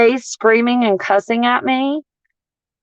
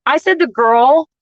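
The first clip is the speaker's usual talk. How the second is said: ordinary delivery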